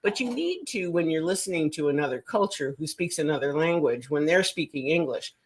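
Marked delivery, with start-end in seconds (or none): none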